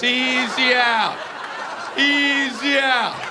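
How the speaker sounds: loud, high, very drawn-out taunt; kids yelling. Retold or imitated. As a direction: stretch the jeer out across the field. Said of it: "they're all out there yelling".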